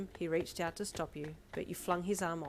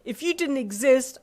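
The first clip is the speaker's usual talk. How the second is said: higher, much louder, slow venom; vehement; 7 semitones higher, 15 dB louder, slower than usual. Acted. Mother spitting blame at her daughter, vehement. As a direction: spit the words at her. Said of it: "she spat vehemently".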